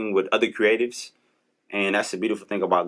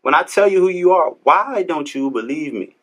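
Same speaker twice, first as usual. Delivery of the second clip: emphatic preaching; 7 semitones higher, 7 dB louder than usual. Retold or imitated. Voicing God, preaching emphatically.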